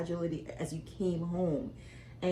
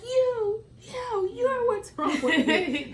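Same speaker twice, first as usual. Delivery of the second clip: put-on much higher, slow, laughing teen retort; mocking the teen daughter; 6 dB louder, much slower than usual. Mother mimicking her teen daughter's comeback, high and laughing. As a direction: play the sulky teenager, laughing through it. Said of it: "And you were like".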